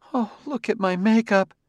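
high, dreary woman's voice. A narrator's reading. Wife seeing her spoiled makeup after crying, dreary. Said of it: "said drearily".

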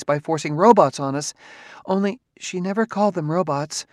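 rambling, nervous wife. A narrator's reading. Wife voiced in story.

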